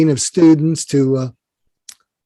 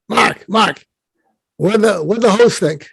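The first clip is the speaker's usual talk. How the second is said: lightly voiced Brooklyn impatience; Larry King, Brooklyn accent; 5 semitones higher than usual. Late mentor interrupts the confession, mildly impatient Brooklyn accent. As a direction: cut in with a hint of Brooklyn exasperation. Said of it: "in his Brooklyn accent".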